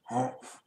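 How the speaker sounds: breathy humph of disgust; a scornful unvoiced humph; grumpy grunt. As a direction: huff it out dismissively. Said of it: "grunted Mister Grumpy".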